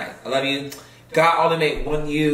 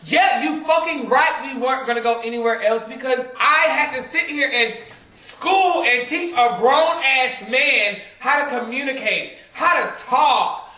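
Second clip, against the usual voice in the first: heated, higher-pitched scolding; real indignant tirade; angry rant; 5 semitones higher than usual. Real, not acted. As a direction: tell him off, really exasperated. Angry rant at absent man.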